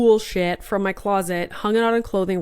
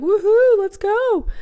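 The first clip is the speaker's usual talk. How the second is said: much higher, louder party cheer; mock party-girl woohoo; party whoop; 13 semitones higher, 6 dB louder, slower than usual. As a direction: whoop like a partying twenty-something. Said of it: "everyone else is gonna be like".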